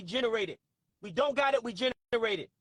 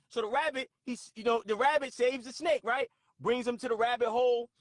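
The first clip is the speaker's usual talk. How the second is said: ordinary talk, nothing marked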